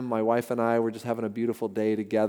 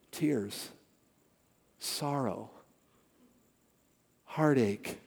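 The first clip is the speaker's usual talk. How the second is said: somber hush; 8 dB quieter, much slower than usual. Acted. Solemn list of sorrows.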